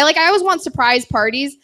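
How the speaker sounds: ordinary delivery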